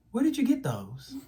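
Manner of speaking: a hint of a mom catching her kid; stern mom. Mom catching her son with stolen candy, a touch suspicious. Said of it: "She's like".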